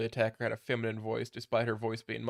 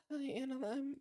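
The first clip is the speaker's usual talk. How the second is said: high, shaky, tearful little voice; shaky muffled voice; 4 dB quieter, slower than usual. Acted. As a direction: choke out a greeting through tears. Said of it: "with a shaky and muffled voice".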